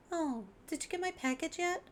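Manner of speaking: put-on high-pitched cheerful girl voice; squeaky bright young girl; high cutesy girl voice. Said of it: "reading it in the wrong voice".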